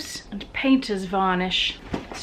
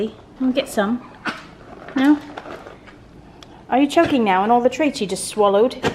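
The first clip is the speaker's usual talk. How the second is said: normal talking voice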